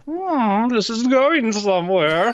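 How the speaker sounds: intrigued onlooker. Acted.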